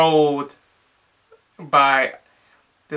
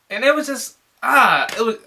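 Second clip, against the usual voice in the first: exasperated; 8 semitones higher than usual. Real, not acted. Exasperated about confusing movie.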